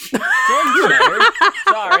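loud laughing fix; laughing correction. Real, not acted. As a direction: laugh out the right name. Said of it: "Oh, sorry".

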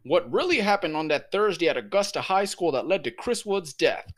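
imitating a polished TV reporter opening a story; proper news reporter. Over the walkie-talkie, copying a viral reporter video, lightly mock formal reporter voice. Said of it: "this is him in front of a school reporting a shooting".

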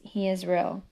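ordinary delivery

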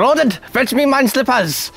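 put-on high, haughty posh British accent; a lord ordering his servant in posh British. Ordering pet guinea pig servant, haughty posh British accent. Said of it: "I'll have a different accent then".

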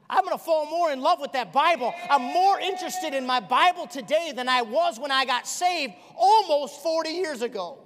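loud, high-pitched revival preaching; preaching at full force. Acted. Preacher thundering at the congregation, full force.

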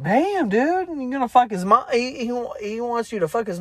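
high-pitched incredulous exclamation; shocked disbelief. Retold or imitated. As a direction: gasp it out in disbelief. Narrator reacting to outrageous line.

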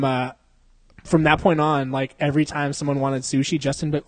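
normal talking voice

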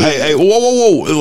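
usual speaking voice

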